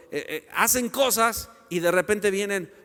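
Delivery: ordinary talk, nothing marked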